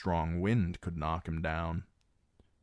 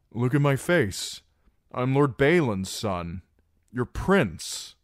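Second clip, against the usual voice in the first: louder, higher pressing words; insistent claim; 6 semitones higher, 6 dB louder than usual. Acted. Reek insists to the guard that he is the prince, loudly.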